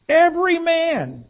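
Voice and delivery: from the pulpit, stressing the phrase to the congregation, a slow high cry of Every man; Every man cried high and slow. Acted. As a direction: pitch Every man way up and drag it out. Preacher repeats the key phrase.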